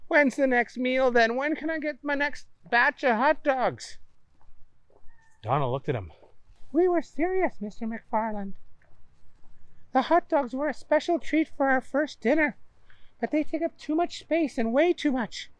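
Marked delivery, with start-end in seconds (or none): 0.1-4.0 high, mocking boy voice; scoffing. Booger demands more. 6.7-15.5 high, polite hostess voice; sweet and helpful, pitched up; Donna's voice. Acted. Donna insists firmly.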